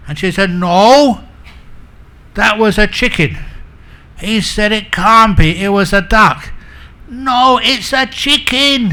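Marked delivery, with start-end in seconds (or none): 0.5-3.4 louder, higher mock-quarrel voice; insistent contradiction. Wife contradicts her husband about the sound, insistent. 4.7-6.5 raised, louder mock-quarrel voice; exasperated arguing. Husband argues back at his wife, exasperated. 7.1-8.9 high, loud, drawn-out contradiction; escalating squabble. Acted. Wife insists to her husband, mock-angry and high.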